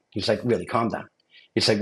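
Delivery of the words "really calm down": softer, imitated reassurance; retold calming. Investigator repeating it to a panicked applicant, quiet calming. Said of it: "he calmed me down".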